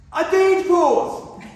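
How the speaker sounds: high, loud, slow protest; heckler shout. Acted. Heckled speaker protests the feedback, loud, high and slow. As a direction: stretch it out in an outraged shout.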